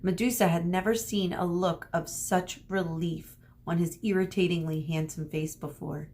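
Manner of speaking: usual speaking voice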